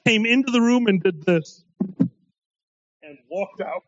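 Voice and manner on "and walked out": normal talking voice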